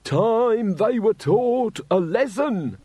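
high, slow, shrill street rant; screaming ranter. A narrator's reading. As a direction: scream it at the crowd. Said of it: "screamed the nearest one".

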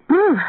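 high, loud shivering "brr"; sudden high-pitched shiver noise; shivery brr. A narrator's reading. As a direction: act out a sharp burst of cold. Freezing girl jumps into car.

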